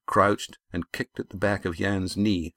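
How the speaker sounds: plain reading voice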